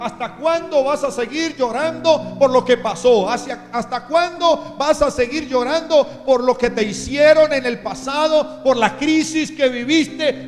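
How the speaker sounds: booming divine rebuke; God's loud rebuke. Acted. God rebukes Samuel, loud sermon.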